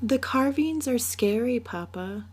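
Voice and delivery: put-on high little girl voice, fretful; a child uneasy about her new bed; scared little girl. Little Mina telling her papa the carvings scare her, in a high child voice.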